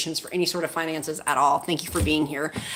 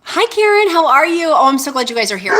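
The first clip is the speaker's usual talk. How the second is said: excited high greeting; 8 semitones higher, 7 dB louder than usual. Real, not acted. Greeting a viewer in live chat.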